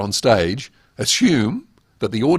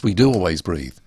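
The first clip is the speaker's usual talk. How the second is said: ordinary talk, nothing marked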